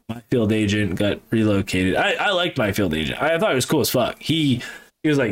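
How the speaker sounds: normal talking voice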